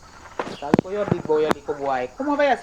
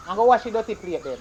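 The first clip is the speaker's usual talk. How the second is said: usual speaking voice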